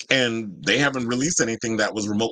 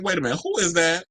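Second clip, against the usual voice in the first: high-pitched retold double take; smitten surprise; 8 semitones higher than usual. Fan retelling first sight of an actor, intrigued surprise. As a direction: play up a sudden, intrigued interest.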